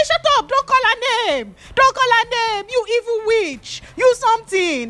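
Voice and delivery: very high hammed-up yelling; mock shrill insults. The boys insulting Ruth in the cell, shrill mock yell. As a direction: screech the insults at her.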